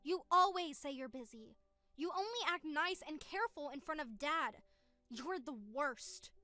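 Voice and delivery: bitter scolding through a phone line; reproachful, over the phone. Acted. Sister accusing her brother of faking care, over the phone. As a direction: call him out, hurt and fed up.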